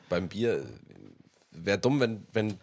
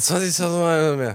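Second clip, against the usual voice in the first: put-on slurred like a drunk; drunken slurring; 9 semitones higher, 7 dB louder than usual. Shown as a demonstration. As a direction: lall the title drunkenly. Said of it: "du kannst das richtig lallen".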